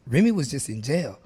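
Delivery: ordinary talk, nothing marked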